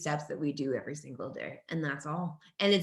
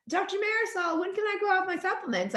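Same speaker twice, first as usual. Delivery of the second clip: much higher, pleading patient voice; imitating a patient; 13 semitones higher than usual. Patient asking her doctor, in a high mock-pleading voice. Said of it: "be like".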